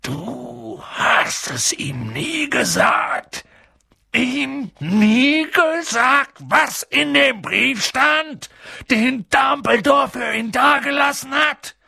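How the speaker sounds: slow, high, outraged giant; trembling with indignation. A narrator's reading. Giant accuses the uncle of hiding the letter, indignant. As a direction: stretch every syllable in rising outrage. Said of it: "zitterte jede Silbe vor Entrüstung".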